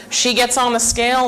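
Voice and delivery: usual speaking voice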